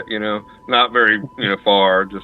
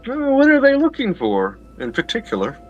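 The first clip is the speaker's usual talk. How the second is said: lady's voice; 12 semitones higher than usual. Acted. Lady asks servant.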